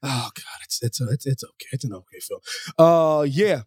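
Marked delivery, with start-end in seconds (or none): 0.0-2.4 much lower, softer reluctant admission; grudging mumble. Pretend. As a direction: concede it under your breath. Grudging concession after teasing.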